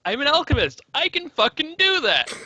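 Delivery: rising to a gleeful high shout; triumphant, building excitement; excited. Real, not acted. Excited discovery of ability.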